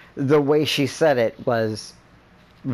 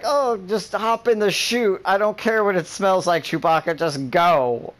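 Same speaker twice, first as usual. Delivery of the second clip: high-pitched, hammed-up impatient order; mock urgent movie hero; 9 semitones higher than usual. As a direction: imitate a harried film hero bossing someone. Acting out Star Wars chute scene.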